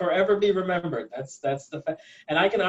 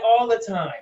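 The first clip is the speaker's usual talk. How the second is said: ordinary delivery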